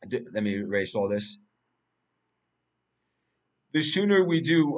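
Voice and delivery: ordinary delivery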